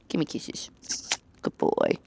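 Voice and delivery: soft whispered cooing. Real, not acted. Asking her cat for kisses, soft whispered coo.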